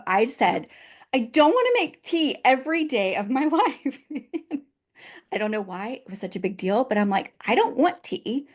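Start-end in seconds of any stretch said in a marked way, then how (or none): 1.1-4.0 higher-pitched, exasperated outburst breaking into a laugh; fed-up protest. Retold or imitated. Wife retelling her identity-crisis moment over tea, fed-up protest. 7.5-8.5 exasperated protest. Retold or imitated. Retells her objection to tea.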